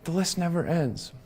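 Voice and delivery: The list never ends, dropped to a hush with weight on list; soft echo. Real, not acted. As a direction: hush it like a weary sigh.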